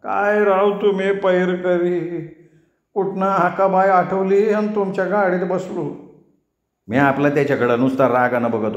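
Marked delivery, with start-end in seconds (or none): 0.0-5.9 higher, slow, aching complaint; groaning barber. A narrator's reading. Barber moans to the narrator about his sore neck, higher and slow. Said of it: "कण्हत कण्हत साधा म्हणला".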